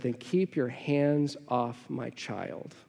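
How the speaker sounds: slow, low and soft, every word deliberate; firm low warning. Retold or imitated. Dad confronting a teen who grabbed his son, slow low warning. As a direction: lay it down quietly, no room to argue. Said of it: "I said".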